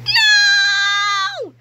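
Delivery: high, loud desperate cry; shout. A narrator's reading. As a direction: scream a warning. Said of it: "cried George".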